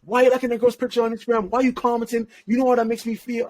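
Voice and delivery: rapid-fire, high-pitched mock grilling; emotional accusation. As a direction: play an upset partner piling on questions. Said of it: "get emotional and be like".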